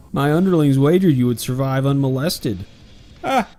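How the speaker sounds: loud, high villain taunt; booming villain. Acted. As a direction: boom it out like a demon lord. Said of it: "It's just".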